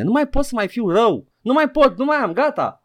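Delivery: high-pitched fed-up outburst; exasperated. Real, not acted. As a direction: pitch it way up, at the end of your rope. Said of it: "Nu mai am energie în mine". (exasperat, la capătul puterilor)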